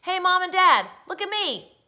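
put-on high, loud boy's call; calling after them. A narrator's reading. Boy to parents walking away, calling out. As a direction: call out for your parents' attention. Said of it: "called after them".

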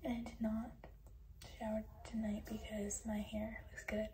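soft late-night whisper. Real, not acted. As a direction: whisper so no one wakes. Bedtime routine, whispering quietly.

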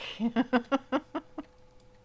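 high, loud burst of laughter. Real, not acted. Player wondering about another backpack, laughing. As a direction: laugh out loud in quick pulses.

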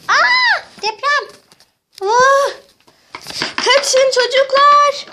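high screamed alarm; hammed-up earthquake scare; acted panic. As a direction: cry out and send everyone running.

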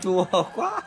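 wincing laugh at sour taste; eating sour fruit. Real, not acted. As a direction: squeal through the sourness. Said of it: "Chua ăn xấu nhỉ".

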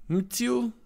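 "Mitsu" as a very high-pitched question; questioning pronunciation. Shown as a demonstration. Reactor unsure of the artist's name, "[name]" as a high question. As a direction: try out "Mitsu" uncertainly, pitched right up. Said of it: "not sure how to pronounce his name".

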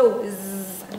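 slow, stretched voiced zzz buzz; drawn-out zzz. Shown as a demonstration. Teacher stretches out the zzz buzz for the class. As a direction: let the zzz hum out slowly. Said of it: "chuẩn bị ra chữ du".

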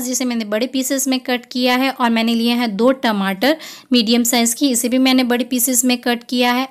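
ordinary talk

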